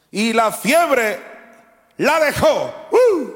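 high, loud and slow, ending in a whoop; preaching climax. Acted. Preacher proclaiming the healing to the church, shouting. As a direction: ring out the miracle at full force.

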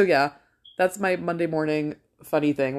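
usual speaking voice

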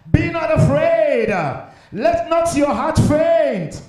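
rousing, insistent pulpit delivery; full-force preaching. Real, not acted. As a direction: exhort the congregation, climbing to a loud peak.

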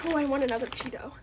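soft fast whisper. Real, not acted. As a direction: whisper it under your breath. Whispered aside.

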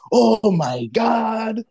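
put-on drawn-out, very slow African-accented oh my god; imitating an African coworker's groan. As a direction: stretch it like the coworker does. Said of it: "Like, he puts his arm".

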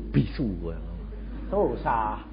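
usual speaking voice

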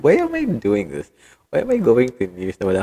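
exasperated. Real, not acted. Laughing at own clumsy news read.

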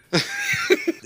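very high, manic cackle; maniacal laughter. Real, not acted. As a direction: cackle like a mad villain. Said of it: "I laugh maniacally a lot".

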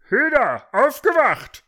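high, loud wake-up shout; roaring rooster officer; throaty bellow. A narrator's reading. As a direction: bellow them awake from the throat. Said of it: "brüllte eine kehlige Stimme".

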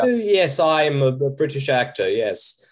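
lightly voiced proper British actor; a quick hint of stage British; posh British accent. Shown as a demonstration. Actor demos how the aristocrats spoke, posh British. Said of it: "the very proper".

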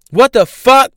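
loud, high disbelieving outcry; real outraged yell; outraged shout. Real, not acted. Outraged at police snatching baby.

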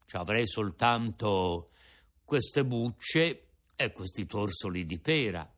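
put-on an old father offering his breakfast; old Geppetto.

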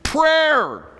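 high loud shout of the word Prayer; shouted answer. Shown as a demonstration.

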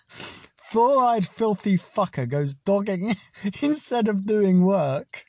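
giggling, slowed right down; reading it out, helpless with laughter; laughing through it. Real, not acted. Reading the insult while laughing.

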